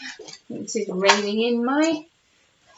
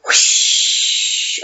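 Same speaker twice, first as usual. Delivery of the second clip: whooshing sound; 4 dB louder than usual. A narrator's reading. Whale spout sound.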